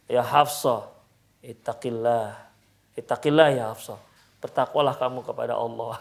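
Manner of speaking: low, sober reminder; retold admonishing. The Prophet reminding Hafsah to fear God, admonishing. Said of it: "Artinya mengingatkan Hafsah".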